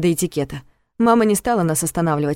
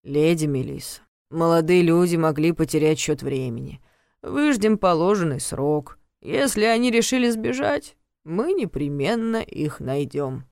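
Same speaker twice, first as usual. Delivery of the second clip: slow, detached official voice; weary guard; slower than usual. Acted. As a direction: recite the rules without caring. Guard character voice held.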